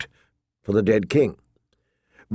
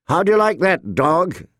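put-on higher-pitched young king; King Joffrey questioning; 6 semitones higher than usual. A narrator's reading. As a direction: toss the question to your underling, pitched up. Boy king addresses his sworn shield.

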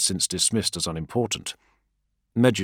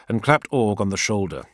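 ordinary delivery, nothing marked

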